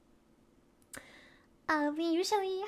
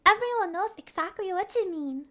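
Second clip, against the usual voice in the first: ordinary talk, nothing marked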